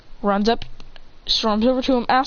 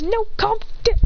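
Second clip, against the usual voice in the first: urgent high plea; 12 semitones higher than usual. Acted. Friend restraining.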